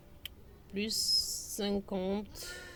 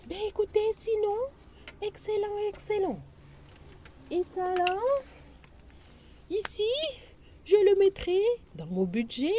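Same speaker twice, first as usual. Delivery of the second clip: much higher, bubbly and delighted; excited; 11 semitones higher than usual. Real, not acted. Vlogger thrilled with her budget savings, high and bubbly.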